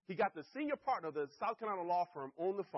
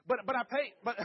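emphatic, louder; 7 semitones higher, 9 dB louder than usual. Shown as a demonstration. Telling the audience his kids' treat cost him, emphatic. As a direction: punch it out high and loud, half laughing.

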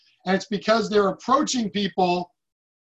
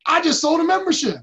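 proud blurt, higher and a bit louder; excited news; 7 semitones higher, 4 dB louder than usual. Retold or imitated. New associate telling his boss about a sale, excited and higher. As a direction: announce it like a breakthrough. Michael reports first sale.

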